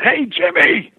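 high, loud acted holler; calling out. Driver hailing his rival Jimmy, acted high holler. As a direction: imitate hailing someone across a distance.

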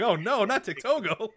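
high, slow lament; mock dismay. Speaker retells fearing a Dick Togo scandal, mock dismayed. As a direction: wail it in hammed-up disappointment.

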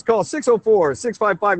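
normal talking voice

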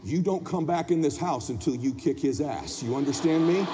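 low-pitched dad ultimatum; stern father. Retold or imitated. Dad sends son next door, stern. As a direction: lay down the law in a low voice.

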